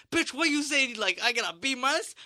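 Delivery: high, indignant ranting; flipping out. Retold or imitated. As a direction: act out losing it at the teacher. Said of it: "me flipping out, being like".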